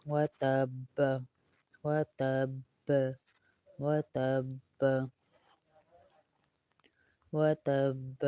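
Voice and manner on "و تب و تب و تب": تب ending with a bouncing echo on ba; qalqalah on ba. Shown as a demonstration. Teacher demonstrates the qalqalah on تب. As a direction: let the ba of تب bounce. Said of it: "پھر اس پہ کلکلا کریں گے".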